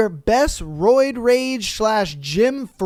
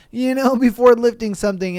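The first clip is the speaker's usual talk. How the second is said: normal talking voice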